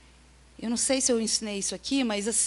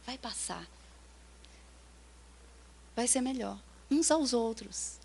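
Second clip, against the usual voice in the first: gentle comfort; 11 dB quieter than usual. Shown as a demonstration. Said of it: "sentar com ela, dar um abraço nela".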